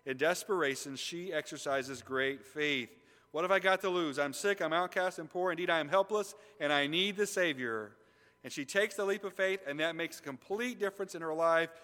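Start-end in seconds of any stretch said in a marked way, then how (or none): 3.3-8.0 voicing the desperate woman. Woman's voiced inner plea.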